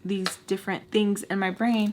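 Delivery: ordinary delivery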